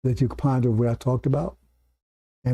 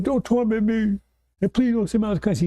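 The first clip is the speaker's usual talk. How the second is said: high, hurried mock pleading; acted-out plea, pitched up and rushed; begging; 7 semitones higher than usual. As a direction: imitate someone begging for mercy. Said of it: "He's begging".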